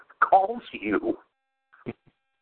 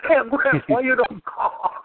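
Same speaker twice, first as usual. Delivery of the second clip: higher, slow mock owner voice; 7 semitones higher than usual. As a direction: plead to know why he didn't call. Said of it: "Open up your toilet".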